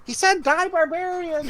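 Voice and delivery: high squeaky goblin voice. Acted. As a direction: relay it as a squeaky little goblin. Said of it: "You hear Splug says".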